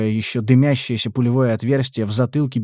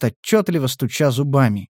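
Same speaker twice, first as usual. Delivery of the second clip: ordinary delivery, nothing marked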